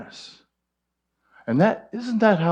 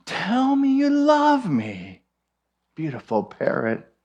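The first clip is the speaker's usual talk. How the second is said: pleading woman; 5 semitones higher than usual. Acted. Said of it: "and she says".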